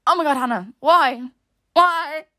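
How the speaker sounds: exasperated, with a rising-pitched second "why"; mortified self-reproach. Retold or imitated. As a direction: scold yourself in mock despair. Retold mortified reproach to herself.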